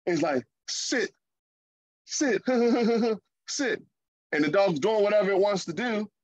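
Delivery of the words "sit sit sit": put-on higher, weak little-boy "sit" with a giggle; little kid's command. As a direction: play the youngest who can't make the dog listen. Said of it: "my little one is like".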